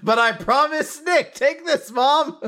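high, loud panicked yelps; freaking out about the bug, high and loud, laughing at the end; panicked bug alarm. Real, not acted. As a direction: shriek it out like a bug just landed. Panics over a bug on screen.

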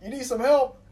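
a hint of the offer to help; lightly voiced stranger. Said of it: "He said".

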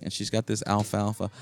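normal talking voice

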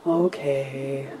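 soft, let-down okay; quiet disappointed okay; deflated okay. Retold or imitated. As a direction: let the air out of it. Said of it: "And I was like".

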